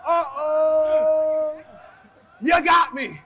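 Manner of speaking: high, loud, laughing uh-oh; caught out, laughing. Retold or imitated. Wrestler admitting his fake accent was exposed, laughing loudly. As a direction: laugh it out like you've been caught.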